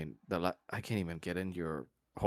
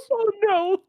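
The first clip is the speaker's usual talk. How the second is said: high, loud laughing oh no; laughing dismay; 10 dB louder than usual. Real, not acted. GM reacts laughing.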